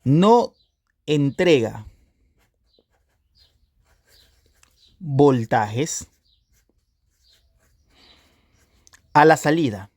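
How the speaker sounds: word by word, long pauses between; slow dictation. Shown as a demonstration. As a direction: dictate it slowly, as if writing it out. Dictates point slowly while writing it.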